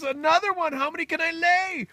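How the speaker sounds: high, loud, incredulous; alarmed amazement. Acted. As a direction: shriek the question in comic alarm. Hen-man alarmed by second egg.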